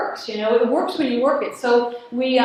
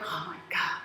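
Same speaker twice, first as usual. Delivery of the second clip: weary sigh; 8 semitones lower, 13 dB quieter than usual. Retold or imitated. Weepy clerk voiced.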